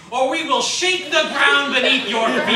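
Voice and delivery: high, loud, laughing mock menace; mock threat. [name] inventing an earthquake threat about unpaid bills, laughing. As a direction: ham up a silly threat. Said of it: "next slide is about earthquakes".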